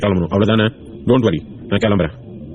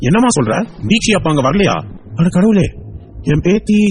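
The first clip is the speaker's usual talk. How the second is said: higher-pitched shocked grandfather; alarmed; 8 semitones higher than usual. Acted. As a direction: voice climbing in mounting dread. Grandfather learns child is missing.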